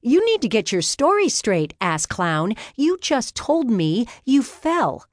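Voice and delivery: high-pitched, exasperated scolding; indignant retort. A narrator's reading. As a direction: tell him off in outrage. Outraged retort to false accusation.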